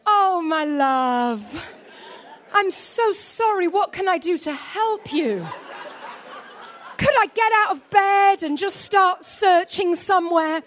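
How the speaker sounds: slow, syrupy imitation of the perfect husband, laughing through it; mock ideal sweet reply. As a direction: ham up the dreamy reply she wanted. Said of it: "I'm ready for".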